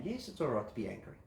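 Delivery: a calm helper answering softly; soft validating reply. Shown as a demonstration. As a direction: drop the voice low and agree gently. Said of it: "validate that anger".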